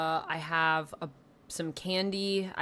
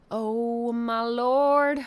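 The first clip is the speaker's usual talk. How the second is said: drawn-out exasperated exclamation; 5 semitones higher, 7 dB louder, much slower than usual. Real, not acted. Overwhelmed setting up stream.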